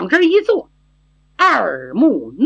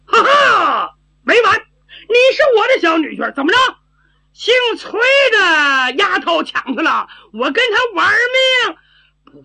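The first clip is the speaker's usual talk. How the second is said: put-on high, loud ranting of a wronged girl; jealous outburst; 11 semitones higher, 4 dB louder than usual. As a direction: wail the threat at a high pitch, stretching the final words. 傻丫头吃醋要拼命. (吃醋撒泼，高声叫嚷)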